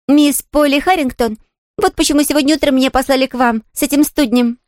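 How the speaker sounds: put-on high, cheerful child's voice; amused little Pollyanna. Girl giving the invalid her real name, cheerful. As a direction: introduce yourself brightly as a high-voiced girl.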